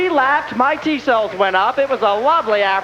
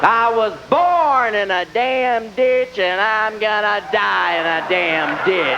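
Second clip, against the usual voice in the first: very slow, weary bitter mother; long-suffering Southern mother moaning slowly; bitter Southern mom; much slower than usual. Retold or imitated. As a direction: drag it out like a martyred mom. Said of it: "classically bitter Southern moms".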